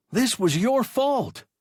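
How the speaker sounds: high, loud accusation; accusing. A narrator's reading. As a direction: stress your fault. Boy accusing, ignoring Hertz.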